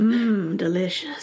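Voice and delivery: breathy whisper, savouring. Pretend. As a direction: whisper it like a tasty treat. Whispered joke.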